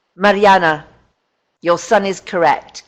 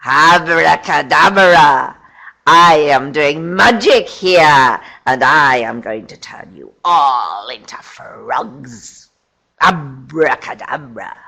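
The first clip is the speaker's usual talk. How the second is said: loud slow witch casting a spell; 3 semitones lower, 5 dB louder, slower than usual. Pretend. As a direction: play a witch doing magic. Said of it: "So they say".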